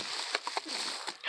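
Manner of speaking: soft, airy gasp; delighted gasp. Real, not acted. Vlogger spotting the next gift, soft delighted gasp. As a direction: gasp quietly at a lovely surprise.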